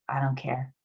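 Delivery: low, soft, can't-be-bothered delivery; apathetic. Acted. Therapist demonstrating apathy to her listeners, apathetic. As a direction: say it with mild, sinking indifference. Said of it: "We begin this state at apathy".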